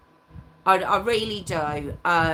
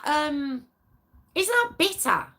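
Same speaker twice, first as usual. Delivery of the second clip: ordinary delivery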